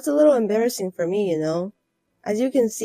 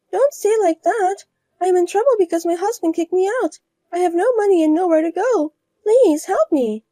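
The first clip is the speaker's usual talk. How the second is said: higher voiced woman begging her ex-rival for a job; begging rival wife; 9 semitones higher, 3 dB louder than usual. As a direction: plead for help, higher.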